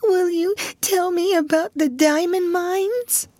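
slow, high plaintive plea; drawn-out high question, rising at the end; whimpering child. A narrator's reading. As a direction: beg for a story in a small child's whimper. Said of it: "whimpered Lottie".

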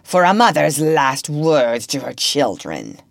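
gloating. A narrator's reading. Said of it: "he called gloatingly".

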